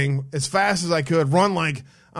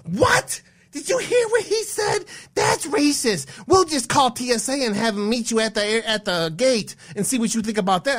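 much higher mock woman's voice, outraged; mocking the flight attendant; 6 semitones higher than usual. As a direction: squeak it out like an offended attendant. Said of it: "She goes".

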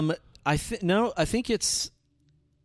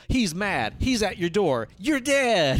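rising higher with each phrase, ending in a high burst; building exclamation; 6 semitones higher, slower than usual. Acted. Summing up the movie's sudden ending, building to a high exclamation. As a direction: punch out three escalating warnings. Mock slasher tagline, first take.

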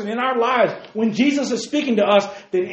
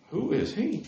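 sheep voice; 3 semitones higher, 9 dB quieter than usual. Pretend. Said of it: "in their little sheep language".

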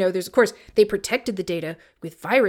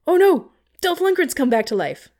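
mock alarm; 8 semitones higher, 7 dB louder than usual. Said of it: "and then you think".

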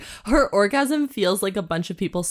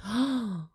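excited gasp; 3 semitones higher, 8 dB quieter than usual. Real, not acted. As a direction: gasp at a sudden idea. Reacts to a new idea.

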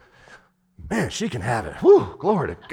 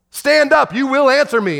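God commanding; 9 semitones higher, 3 dB louder than usual. Acted. God voiced commanding Job.

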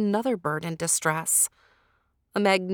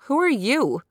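higher, louder startled question; 7 semitones higher, 5 dB louder than usual. A narrator's reading. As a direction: Sapana asks the stranger in alarm. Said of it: "said Sapana".

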